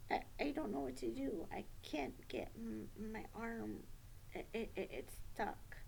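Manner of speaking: soft, halting acted worry; helpless stammer. Child with an arm stuck in the coat, softly helpless. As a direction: stammer quietly as if stuck and at a loss.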